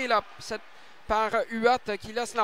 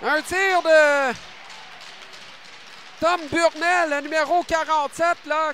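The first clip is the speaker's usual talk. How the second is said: shouting the goal, much higher and louder; excited goal call; 5 semitones higher, 7 dB louder than usual. Real, not acted. Goal scored, commentator calls it.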